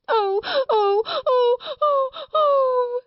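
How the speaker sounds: very high, loud repeated wails; tempestuous child's howling. A narrator's reading. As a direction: howl like a child in a tantrum. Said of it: "Lottie howled tempestuously".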